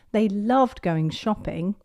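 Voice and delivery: ordinary delivery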